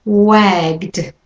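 Wagged, G straight into D, one syllable; wagged with a final d. Shown as a demonstration. Teacher models wagged for learners. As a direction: close the G right into D in Wagged. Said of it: "And once more, have a practice".

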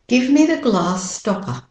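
British-Australian long "ah" in "glass"; no final R in "stopper", British-Australian style; British-Australian model sentence; British-Australian accent. Shown as a demonstration. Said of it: "And with the American accent".

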